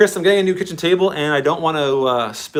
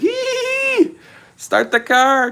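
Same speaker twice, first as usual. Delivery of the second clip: high delighted squeal, then a higher excited order; squealing like a kid; much slower than usual. Retold or imitated. Retelling his shop excitement to chat, high squealing. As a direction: squeal like a little kid who just got a toy. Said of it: "I was squealing like a little kid".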